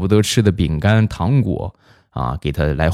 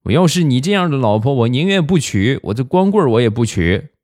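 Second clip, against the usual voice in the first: mock-indignant, higher-pitched retort; huffy kid voice, pitched up; angry little boy; 7 semitones higher than usual. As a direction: imitate a boy stubbornly refusing mom. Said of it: "小家伙很生气的就说".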